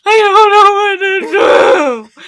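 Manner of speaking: loud, high, slow crying shout. Retold or imitated. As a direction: wail it out in despair. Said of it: "I was like".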